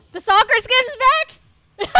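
drawn-out excited yelp; shocked excitement. Real, not acted. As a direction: burst out in disbelief. Sees the soccer skin again.

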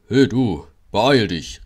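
loud, gruff order to hurry; annoyed call. A narrator's reading. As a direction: call out crossly to a latecomer. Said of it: "rief Gordon ärgerlich".

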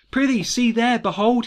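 loud, high theatrical exclamation; alarmed cry. Acted. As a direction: call out urgently to make them look. Macbeth cries out at the ghost.

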